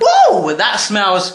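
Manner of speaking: a high, loud burst of delight; surprised whoa. Real, not acted. Cook opening the oven to viewers, delighted surprise. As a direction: caught off guard by the smell. Oven dish out, smell hits.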